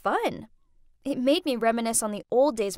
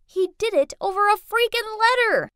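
high, louder indignant complaint; exasperated; 8 semitones higher, 5 dB louder than usual. Acted. Jilted woman telling his sister about the breakup, exasperated. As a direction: build to outraged disbelief.

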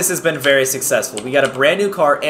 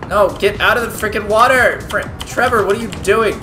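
ordinary delivery

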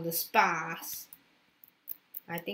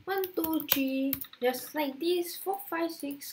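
counting demo; 7 semitones higher than usual. Shown as a demonstration. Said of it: "Count like this".

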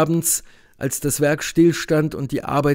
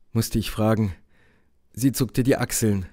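ordinary delivery, nothing marked

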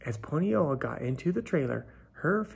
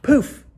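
high, loud "Poof!"; storyteller popping the magic sound, high and loud; 12 semitones higher, 13 dB louder than usual. Acted. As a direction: burst it out like a magic trick. Narrator voices magic effect.

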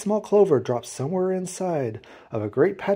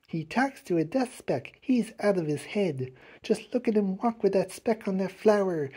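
softer, higher scandalised gossip; gossiping jungle creatures; 5 semitones higher, 4 dB quieter than usual. A narrator's reading. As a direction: pass it on in a hushed voice. Jungle gossip spreading the news.

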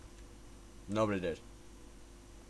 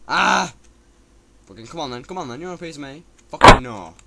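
slurred aggressive taunting; 3 semitones higher, 5 dB louder than usual. Real, not acted. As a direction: pick a fight, loud and sloppy. Yelling at game enemy.

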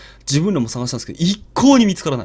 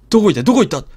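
panicked calling; 3 semitones higher, 7 dB louder, much faster than usual. Retold or imitated. 子供が消えて慌てる.

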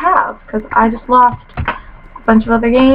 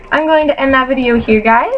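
ordinary talk, nothing marked

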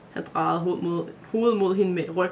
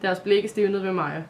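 plain reading voice

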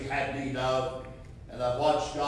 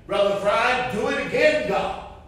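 fervent plea; 6 semitones higher, 3 dB louder than usual. Acted.

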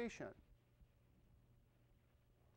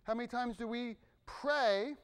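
normal talking voice

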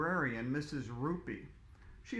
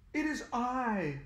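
grand announcement; 8 semitones higher, slower than usual. Acted. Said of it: "announced the superhero".

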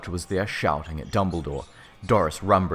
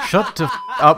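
laughing; 10 semitones higher, 6 dB louder than usual. Real, not acted. Laughing at author's note.

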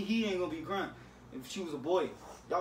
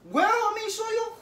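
high, loud squeal; teasing brother squealing at his sister; high squeaky coax; 9 dB louder than usual. Real, not acted. Coaxing sister to show face.

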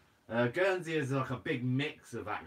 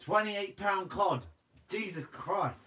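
amazed; 7 semitones higher, slower than usual. Real, not acted. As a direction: stretch it out in disbelief. Reads chat, amazed at catch.

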